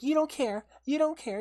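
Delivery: imitating the girlfriend's angry retort; mock accusing partner. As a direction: hurl it back at him accusingly. Mimicking partner's accusation in fight.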